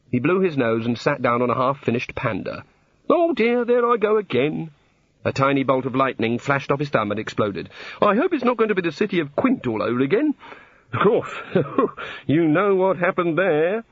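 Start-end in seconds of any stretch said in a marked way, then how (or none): 3.1-4.7 high-pitched dismay; flustered god. A narrator's reading. God setting off another bolt, flustered. 8.0-13.8 high-pitched, nervously rambling deity; fretful god. A narrator's reading. God recalling a past disaster, fretful.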